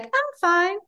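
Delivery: put-on high, light kid's voice; imitating the kid. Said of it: "the kid said, like".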